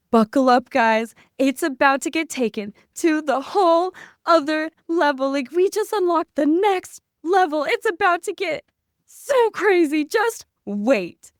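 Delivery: loud, high, hyped call to the crowd; rally everyone like a ride is launching; hyped-up announcement. Retold or imitated. Turning round to the crowd at the concert, loud hyped call.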